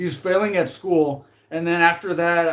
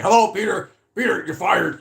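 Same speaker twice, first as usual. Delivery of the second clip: lightly put-on boss voice, pitched up; mock movie-boss delivery, higher at the start; imitated boss; 5 semitones higher, 4 dB louder than usual. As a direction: play the boss announcing a firing. Voices boss firing Peter.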